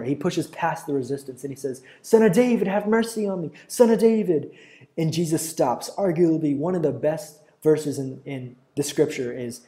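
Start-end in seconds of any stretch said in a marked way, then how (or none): 2.0-4.5 high, loud, desperate call; crying out all the more. A narrator's reading. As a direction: push past the crowd with your voice. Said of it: "he cries out all the more".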